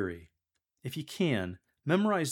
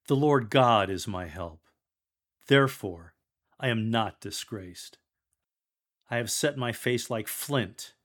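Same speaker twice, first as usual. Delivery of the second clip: louder, bold scripture reading; confident proclamation; 4 dB louder than usual. Acted. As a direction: declare it unconquered and unashamed.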